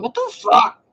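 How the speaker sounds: high, loud startled what the fuck; shocked outburst. Retold or imitated. Food spilling from her mouth, a shrill startled curse. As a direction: shriek it as food falls out. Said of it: "I'm like".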